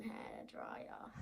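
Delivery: soft whisper. Real, not acted. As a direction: whisper it quietly. Child whispering on camera.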